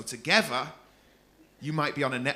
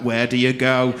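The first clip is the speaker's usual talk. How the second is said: louder, lower, mock creepy asking; creepy questioner; 4 semitones lower, 9 dB louder than usual. Comedian mocking his own audience questions, louder and lower, hammed-up creep. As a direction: play up how creepy the question sounds. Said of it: "I sound awful".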